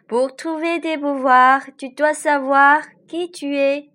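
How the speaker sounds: high, loud lake spirit voice; lady of the lake. A narrator's reading. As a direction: give the lake lady a lifted voice. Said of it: "dit la voix du lac".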